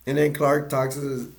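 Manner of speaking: normal talking voice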